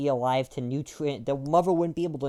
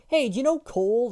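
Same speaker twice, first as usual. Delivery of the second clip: high, louder mock of a know-nothing; mocking dumb voice; 9 semitones higher, 5 dB louder than usual. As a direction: sound proud of a silly mistake. Mocking people who know nothing.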